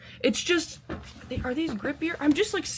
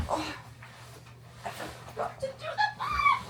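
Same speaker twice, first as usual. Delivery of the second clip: a sudden very high cry of realisation; surprised shriek; 5 dB quieter than usual. Real, not acted. Hiker to camera, realising she skipped the math, shrieking. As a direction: blurt it out in alarm, way up high.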